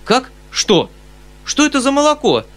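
high, incredulous uncle; astonished outcry. A narrator's reading. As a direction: cry out in utter bafflement. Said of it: "вскричал дядя, смотря на меня в изумлении".

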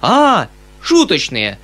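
loud, drawn-out "ah" of relief; beaming uncle's happy outcry; delighted realization. A narrator's reading. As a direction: exclaim slowly as it dawns on you. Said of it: "вскричал дядя с просиявшим лицом".

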